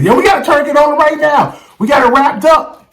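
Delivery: high-pitched imitation of a woman; put-on high female voice; woman on scene. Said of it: "A woman on scene said".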